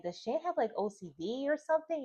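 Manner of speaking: a high quizzical aside to herself; puzzled wondering. Retold or imitated. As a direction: ask it like you can't believe it. Quoting her own puzzled thought.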